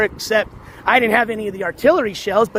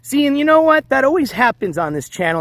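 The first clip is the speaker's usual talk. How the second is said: normal talking voice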